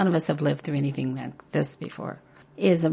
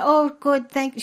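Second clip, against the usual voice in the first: put-on light Irish accent; 10 semitones higher, 5 dB louder than usual. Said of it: "She was Irish, had a little Irish accent".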